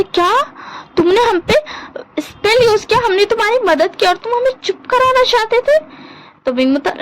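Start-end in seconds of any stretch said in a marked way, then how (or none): 0.0-5.8 high, indignant outburst with a whiny catch; high-pitched shocked protest at being silenced; outraged overreaction. Acted. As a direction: act hurt and outraged, way over the top. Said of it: "ज़्यादा over react मत करो".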